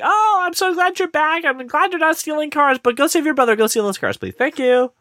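high put-on doting mom voice; squeaky sweet mother pleading; mock mother. Imitating the mom begging her son to save his brother, high and sweet. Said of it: "she's like".

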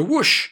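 long, loud rushing whoosh. A narrator's reading. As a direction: imitate a big rush of wings. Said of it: "a loud, long, fluttering whoosh".

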